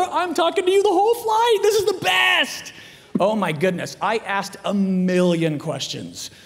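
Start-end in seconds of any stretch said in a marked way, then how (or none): 0.0-2.7 high, louder gleeful shouting; thrilled outburst. Retold or imitated. Comic thrilled to talk with his seatmate all flight, high excited shout. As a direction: build to a stretched, elated yell.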